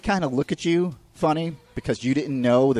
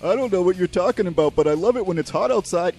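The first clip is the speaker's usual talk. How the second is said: high, dim-witted doofus voice; mocking the guitar store clerk who knows nothing, dopey voice; 6 semitones higher than usual. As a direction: play the clueless store clerk, slack and goofy. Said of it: "He's like".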